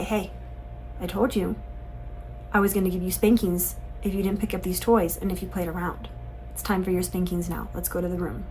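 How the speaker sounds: low, soft, matter-of-fact parental voice; calm firm parent. Acted. Parent telling a disobedient child it's time, calm and quiet.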